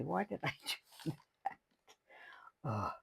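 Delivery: ordinary delivery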